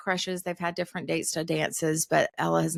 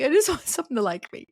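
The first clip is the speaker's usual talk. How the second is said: higher, laughing imitation of a wistful teen; half-laughing teenage plea; mock teenage pleading; 7 semitones higher, 3 dB louder than usual. Mom voicing herself at seventeen, mock pleading.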